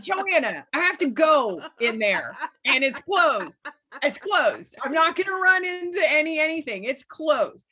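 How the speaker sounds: high, loud, exasperated insisting; insistent pleading. Retold or imitated. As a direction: plead your case, exasperated. She argues back, insisting.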